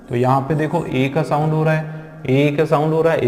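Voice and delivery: usual speaking voice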